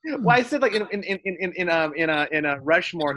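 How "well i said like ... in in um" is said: ordinary talk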